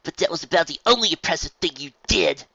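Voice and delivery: high, loud mocking put-down; sneering Spitfire. Spitfire taunting Rainbow Dash, loud sneering voice. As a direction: cut her down with scorn.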